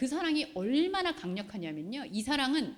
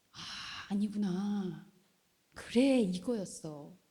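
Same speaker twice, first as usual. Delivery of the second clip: realizing sigh; 3 semitones lower, slower than usual. Retold or imitated. Voicing realization in prayer.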